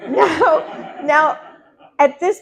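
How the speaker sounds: laughing. Real, not acted. Amused by the saleswoman's pitch, laughing out loud. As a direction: let the laugh break into the words.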